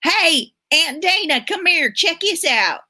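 put-on high, excited little-boy call; imitating nephew's yell. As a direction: call out like an eager small nephew. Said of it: "he literally yelled to me".

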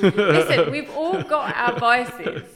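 loud laughing, slow. Real, not acted. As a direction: laugh out the words. Laughing at co-host's confession.

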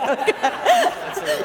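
a high burst of laughter at the volunteers. Real, not acted.

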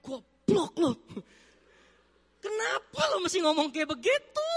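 mock inner rant at his own foolishness; scolding himself. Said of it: "Dalam pikiran saya bilang".

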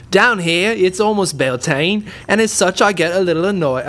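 bad Australian accent. Shown as a demonstration. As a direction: ham up a terrible Australian accent, and know it. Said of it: "I can't do an Australian accent".

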